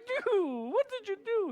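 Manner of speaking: high, loud plea of disbelief; exasperated outcry. Retold or imitated. To Ben, demanding in a loud high voice. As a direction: yelp it up high at the friend. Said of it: "I'm like".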